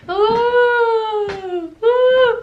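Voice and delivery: high, loud "ooh" of excitement; thrilled ride cry. Retold or imitated. Riding the fun fair ride, high excited ooh. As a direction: squeal like the ride just lifted.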